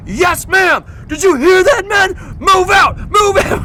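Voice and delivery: high, loud, hammed-up soldier yell; barked mock-military orders; mock army shout. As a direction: shout back like an eager recruit. Mocking her barked orders back.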